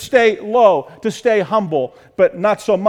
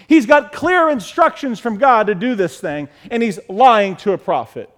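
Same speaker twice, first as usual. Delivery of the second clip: ordinary talk, nothing marked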